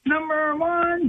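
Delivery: NUMBER ONE drawn out high and slow; said the right way. Shown as a demonstration. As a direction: stretch "Number one" into a high, held chant. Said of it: "You gotta say it the right way".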